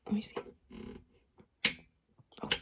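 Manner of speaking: normal talking voice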